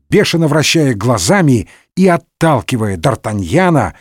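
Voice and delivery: plain reading voice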